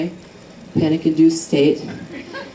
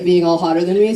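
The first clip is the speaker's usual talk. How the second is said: usual speaking voice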